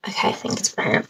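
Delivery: soft breathy whisper to herself; quick whispered aside. Real, not acted. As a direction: murmur it off-mic while fixing the light. Aside while fixing the light.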